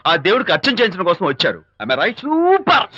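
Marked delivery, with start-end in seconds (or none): 2.2-3.0 high, drawn-out exclamation; excited exclamation. Acted. Woman's purpose at temple guessed right, excited. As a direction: stretch it out high, then let it fall.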